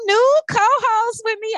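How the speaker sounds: high, slow hype build-up; excited. Real, not acted. Host teasing her new co-host to listeners, high and excited. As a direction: sell the reveal of the new co-host.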